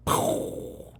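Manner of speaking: voiceless pistol-blast noise; mimed gunshot. Retold or imitated. Waiter imitating customer's finger pistol, breathy blast noise. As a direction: imitate a gun going off with your breath. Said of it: "puts two fingers in the pistol form".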